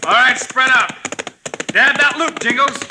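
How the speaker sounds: high ringing heroic calls; brash old-fashioned hero bravado. Acted. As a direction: bold cowboy hero shouting orders. Hero calling out during roundup.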